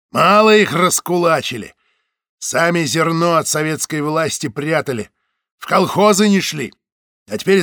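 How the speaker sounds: a hint of a loud, coarse guard; a slightly voiced guard lecturing a passenger; lightly voiced guard. A narrator's reading. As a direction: lecture her with crude friendliness. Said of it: "почти доброжелательно прибавила Фуражка".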